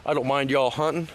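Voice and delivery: usual speaking voice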